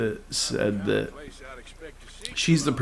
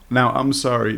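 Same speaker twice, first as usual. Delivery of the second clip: normal talking voice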